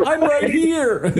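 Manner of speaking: high, drawn-out taunt; mock calling out. Prankster revealing himself to bartender, high taunting call. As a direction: sing out gleefully across the room.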